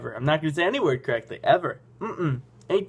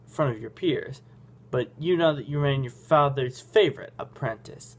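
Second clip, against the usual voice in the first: normal talking voice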